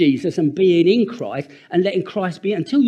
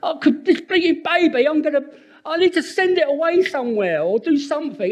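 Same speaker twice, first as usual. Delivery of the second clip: put-on exasperated old self; 11 semitones higher than usual. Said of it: "my old self is like".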